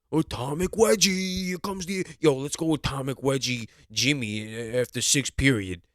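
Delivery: mock school bully. Imitating school bully taunts.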